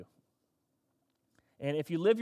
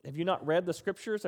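normal talking voice

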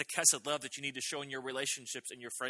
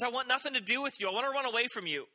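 put-on much higher, louder childish bunny voice; a little bunny refusing his mother; defiant little bunny; 12 semitones higher, 6 dB louder than usual. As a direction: play the pouting runaway bunny.

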